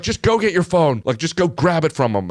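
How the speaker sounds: high-pitched imitation of her urging; insistent order. Retelling her urgent orders to him.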